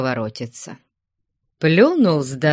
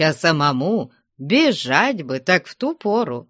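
plain reading voice

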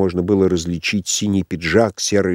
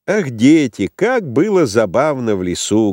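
higher, louder gleeful exclamation; carefree voice; 5 semitones higher, 3 dB louder than usual. A narrator's reading. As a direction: gush happily about the outing. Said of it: "слышала беспечный голос". (беспечно, с оживлённым восторгом)

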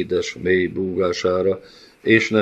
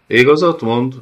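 sharp cry, higher and louder; snapping shout from the pit; shouted from below; 6 semitones higher, 7 dB louder than usual. A narrator's reading. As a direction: call it up from the depths. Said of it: "csattant fel a mélyből Felice kiáltása".

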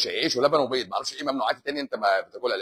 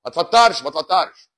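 ما تفطرش punched loud and rising; emphatic mock ruling of ما تفطرش; 5 semitones higher, 9 dB louder than usual. Host mocking a sheikh, loud ما تفطرش. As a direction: snap back ما تفطرش as the opposite ruling. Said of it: "يقول له".

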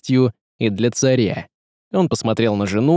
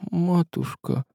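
tearful; 6 semitones higher, 6 dB quieter, slower than usual. A narrator's reading. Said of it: "И заплакал".

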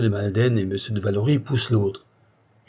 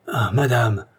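furious; slower than usual. A narrator's reading. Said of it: "s'écrie Isidore furieux".

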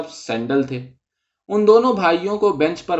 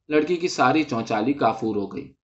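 ordinary delivery

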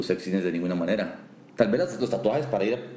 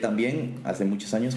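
ordinary delivery

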